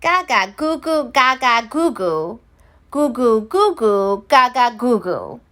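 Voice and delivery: put-on cooing baby talk; baby babble. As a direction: babble like an infant. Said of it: "all the elves heard was".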